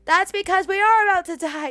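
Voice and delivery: loud mock panic.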